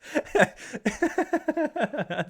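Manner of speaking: high-pitched fit of real laughter; long laugh. Real, not acted. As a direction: crack up laughing helplessly. Cracks up after reading note.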